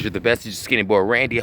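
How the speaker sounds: ordinary talk, nothing marked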